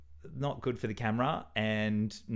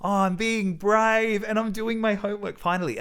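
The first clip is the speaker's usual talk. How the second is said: high, bright self-cheering; proud little pep talk to himself; excited inner voice; 10 semitones higher, 3 dB louder than usual. Retold or imitated. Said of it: "And so I felt really like".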